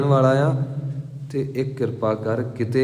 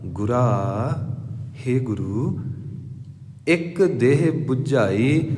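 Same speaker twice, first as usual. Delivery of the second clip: ਗੁਰਾ as a call, a pause, then ਇੱਕ ਦੇਹ ਬੁਝਾਈ slow; correct reading; much slower than usual. Shown as a demonstration. Preacher gives the correct reading of the verse, slow and paused. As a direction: read it as a prayer, with pauses after ਗੁਰਾ. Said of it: "ਪਰ ਸਹੀ ਪਾਠ".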